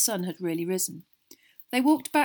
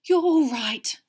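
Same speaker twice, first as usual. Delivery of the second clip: higher, louder distracted voice; overwrought, barely taking it in; distracted relief; 7 semitones higher, 8 dB louder, slower than usual. A narrator's reading. Mother checking her family over, distracted. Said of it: "Missus Weasley muttered distractedly".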